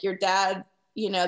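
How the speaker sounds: ordinary talk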